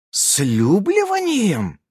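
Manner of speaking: slow, drawn-out astonished question; mock amazement, stretching it; a touch of disbelief; amazed. A narrator's reading. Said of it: "поразилась Алиса Лис".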